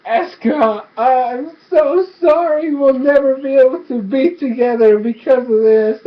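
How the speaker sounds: hammed-up tearful lover's apology; bawling. Bolin apologizing to Eska from the ice, mock bawling. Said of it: "Bolin just starts bawling".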